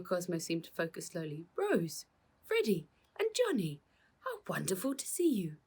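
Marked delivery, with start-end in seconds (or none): 1.5-5.6 high, bright joy at each name; delighted greeting. A narrator's reading. Cosmo delighted to see the children, high joyful greeting.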